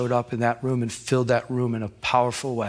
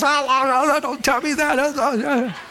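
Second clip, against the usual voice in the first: high, squirming protest with a laugh; mock squirming; 11 semitones higher than usual. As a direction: pretend to cringe away from it. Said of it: "And I was".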